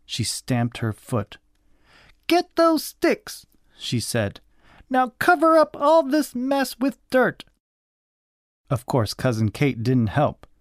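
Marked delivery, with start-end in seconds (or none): 2.3-3.4 very high, slow command; drawn-out cross child command; cross bossy cousin. A narrator's reading. Cross cousin giving orders. 4.9-7.4 high-pitched cross command; high, bossy child scolding; cross bossy cousin. A narrator's reading. Cross cousin giving orders.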